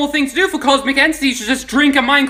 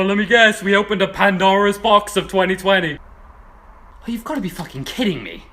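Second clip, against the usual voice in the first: dismayed groan; 7 semitones lower than usual. Acted. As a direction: mutter it in disbelief. Dismayed low aside.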